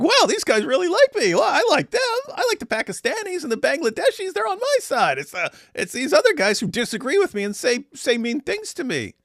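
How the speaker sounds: put-on very high, childish falsetto parody; mocking impression. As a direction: play him as a flattered, whiny child. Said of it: "and he's like".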